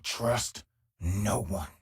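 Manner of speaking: mock ominous. Dark joke to other self.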